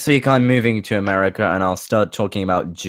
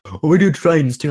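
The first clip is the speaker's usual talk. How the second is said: higher, louder mocking voice; 8 semitones higher, 5 dB louder than usual. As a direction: toss it off like a smug company. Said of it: "it's like".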